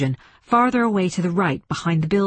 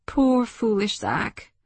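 pitying thought; 4 semitones higher, slower than usual. A narrator's reading.

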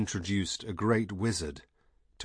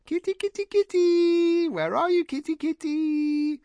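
high sing-song kitty call; calling a cat; 6 dB louder than usual. A narrator's reading. Heather calls the kitten.

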